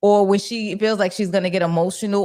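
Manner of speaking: usual speaking voice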